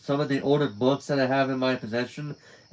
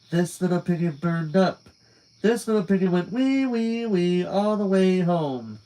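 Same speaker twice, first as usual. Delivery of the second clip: eerie singsong; 5 semitones higher than usual. Acted. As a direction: chant it like a madwoman. Alice's mad nursery rhyme.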